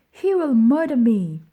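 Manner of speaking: high, loud panicked scream; terrified scream. Acted. Bully Noah screaming as Oliver knocks him down, panicked shriek. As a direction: shriek it like the boy is being killed. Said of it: "screamed Noah".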